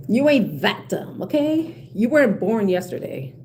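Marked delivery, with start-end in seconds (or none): none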